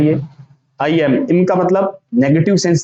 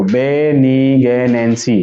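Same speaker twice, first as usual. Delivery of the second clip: slow, lower, stretched "बेनिग्नेंसी"; syllable by syllable; 3 semitones lower, much slower than usual. Shown as a demonstration. Teacher telling students to look, slowly in syllables. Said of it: "इधर देखो".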